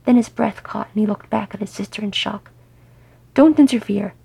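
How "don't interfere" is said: loud, higher, stern command. Acted. Thorin warning Gimli not to interfere, sternly. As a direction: order him off firmly. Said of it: "Thorin said to him sternly".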